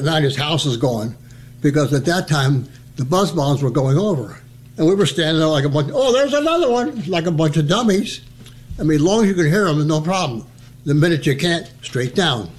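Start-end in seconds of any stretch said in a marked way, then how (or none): 5.9-7.0 high, wide-eyed gawk; naive gawking. Retold or imitated. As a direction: point up like a dummy. Gawking at buzz bombs.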